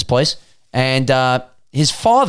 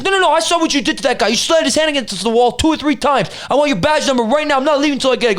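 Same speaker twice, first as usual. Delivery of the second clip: high-pitched, insistent retold protest; mock indignant arguing; 8 semitones higher, faster than usual. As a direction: act out a witness refusing to back down. Retold bystander arguing with officer.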